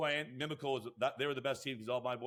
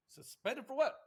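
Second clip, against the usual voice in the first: retold high-pitched disbelief; incredulous question; 10 semitones higher than usual. Coach hears he's suspended, replayed with incredulity. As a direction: act out being taken aback. Acted reaction to suspension news.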